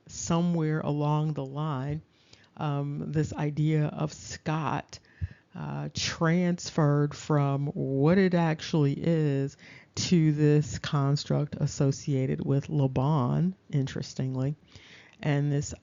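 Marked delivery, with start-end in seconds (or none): none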